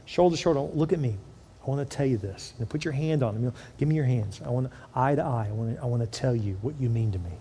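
soft, low, close and earnest; quietly showing how to bless a child; tender blessing demo. Shown as a demonstration. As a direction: speak it eye to eye, gently. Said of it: "You wanna say".

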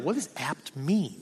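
soft, slow puzzled mutter; muttered question. Retold or imitated. Looking up a word, wondering quietly to himself. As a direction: ask it quietly to yourself. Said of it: "I was like".

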